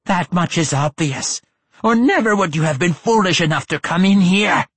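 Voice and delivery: high lich voice building to a loud burst; rising lich outburst. A narrator's reading. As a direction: swell from menace to a roar. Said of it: "the Lich ended with a sudden upswing in volume and intensity".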